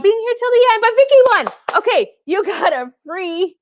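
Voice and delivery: high, bright announcement of the winner; excited. Real, not acted. Host cheering the winner to her viewers, high and excited. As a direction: pitch it up with delight.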